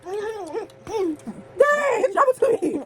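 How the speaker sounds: high pained moans with a burning mouth; mouth burning from spicy food. Real, not acted. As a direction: whimper through the spice burn. Spice burning, cries out.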